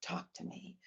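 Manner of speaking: put-on very soft whisper to the child; whispered invitation. Shown as a demonstration. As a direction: softly invite the child to talk. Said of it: "then I'll tell the child".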